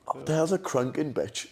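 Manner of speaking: laughing baffled Dad, repeated. Retold or imitated. Retelling Dad's question to his brother, laughing. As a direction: redo the quote while cracking up.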